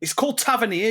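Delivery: mocking French Tavernier; mock French pronunciation. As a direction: sneer out Tavernier like a pundit. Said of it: "putting a French accent on everything".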